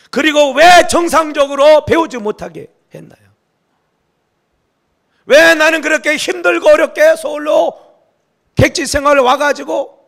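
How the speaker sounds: loud, high, slow outcry at God; complaining prayer. Retold or imitated. Pastor retelling his own complaint to God, as a loud bitter outcry. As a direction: reenact crying out bitterly to God. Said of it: "제가 막 불평했다니까요".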